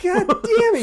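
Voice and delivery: very high, loud, laughing curse; hammed-up annoyance through a laugh; mock frustrated curse. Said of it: "He's like".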